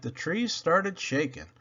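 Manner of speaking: ordinary delivery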